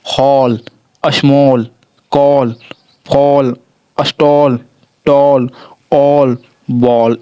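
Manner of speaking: हॉल, स्मॉल, कॉल said with a clear open ओ sound; teacher modelling words one by one; model pronunciation. Shown as a demonstration. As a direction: read each word crisply, stretching ऑल, बॉल slowly. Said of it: "ओ का उच्चारण दे रहे हैं".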